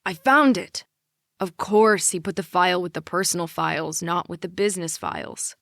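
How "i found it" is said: loud, high triumphant shout; excited scream. Acted. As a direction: yell it out in triumph. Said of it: "Nick suddenly screams".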